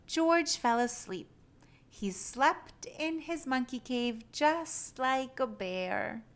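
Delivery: ordinary delivery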